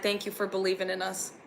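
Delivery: ordinary talk, nothing marked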